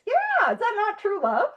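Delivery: high, put-on starry-eyed question; jokingly defensive, lifted voice; mock-innocent. Mock defence of her romance.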